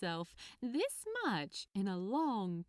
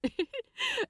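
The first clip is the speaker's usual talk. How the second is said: light amused chuckle; a quick bright laugh; 5 dB louder than usual. Acted. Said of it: "replied with a chuckle".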